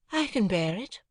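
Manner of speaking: put-on cool, airy society girl; Estella. Estella voiced, higher.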